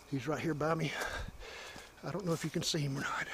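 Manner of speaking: hushed. Real, not acted. Hushed near a squirrel.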